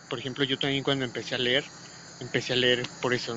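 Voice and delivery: normal talking voice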